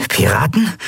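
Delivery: high-pitched, nervous question. A narrator's reading. Larn asks behind his master's back, nervous. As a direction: ask it with a jolt of nerves. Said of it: "Er klang nervös".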